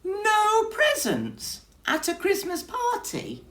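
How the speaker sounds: very high, loud squeaky disbelief; high mouse voice, aghast; dismayed mouse. Acted.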